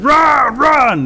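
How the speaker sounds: high, loud, urgent shout; shouted warning. A narrator's reading. Rabbit running towards the animals, shouted warning. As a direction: yell it out to scatter everyone.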